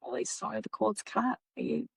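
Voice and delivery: gentle apology. Retold or imitated. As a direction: break the news gently. Gently telling spirit it's over.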